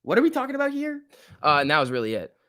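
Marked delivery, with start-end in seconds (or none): 0.0-1.0 high-pitched, baffled self-quote; mock bewilderment. Streamer retelling his reaction to class talk, high-pitched bewilderment. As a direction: squeak it out in mock disbelief. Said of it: "And I was like".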